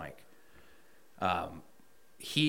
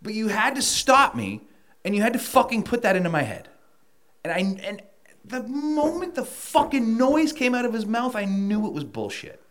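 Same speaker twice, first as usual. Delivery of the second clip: higher, indignant, venting; heated rant; 12 semitones higher, 5 dB louder than usual. Real, not acted. Ranting to the host about the company's empty promise, heated and high. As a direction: let the frustration boil over.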